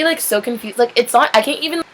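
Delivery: ordinary delivery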